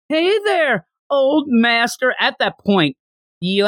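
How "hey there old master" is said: high, coy greeting. Retold or imitated. Oolong as Bulma greeting the old master, high and coy. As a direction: purr it like a flirt. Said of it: "he comes out".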